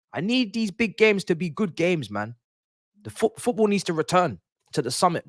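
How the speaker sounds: ordinary talk, nothing marked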